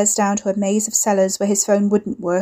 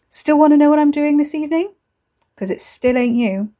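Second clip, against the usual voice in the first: taunting voice; 7 semitones higher, 4 dB louder than usual. Acted. Ricky taunting Wes on phone.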